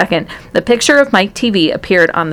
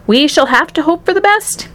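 higher, lilting gentleman's voice; consoling Wonka; 9 semitones higher than usual. A narrator's reading. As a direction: offer comfort in a raised, singsong pitch. Wonka consoling the distraught mother.

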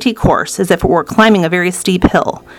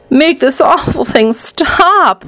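high, drawn-out demand; distressed order; 7 semitones higher, much slower than usual. A narrator's reading. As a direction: order it stopped, stretching every word. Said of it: "ordered Mister T V".

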